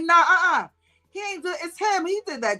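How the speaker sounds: high, emphatic no-no; excited protest. Real, not acted. Defending Timmy, high indignant protest. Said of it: "I'm like".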